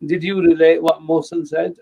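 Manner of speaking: ordinary delivery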